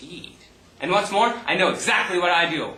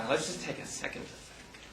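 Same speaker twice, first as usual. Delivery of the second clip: soft unvoiced whisper; 15 dB quieter than usual. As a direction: whisper it to yourself. Sighs, thinks to himself.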